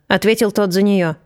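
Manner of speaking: usual speaking voice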